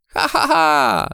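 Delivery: high, drawn-out peal of laughter; laughing. A narrator's reading. Maja laughs at her frightened friend, merry laugh. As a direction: laugh merrily, ha-ha-ha. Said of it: "śmiała się".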